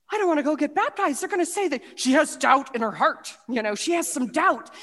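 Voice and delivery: fast, high, loud rush of worry; panicky rush. Retold or imitated. Fearing the prayer team will see her doubt, a fast panicky blurt. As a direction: blurt it out before your nerve breaks.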